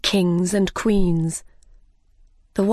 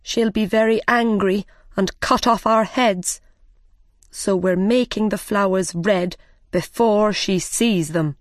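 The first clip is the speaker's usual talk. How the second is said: plain reading voice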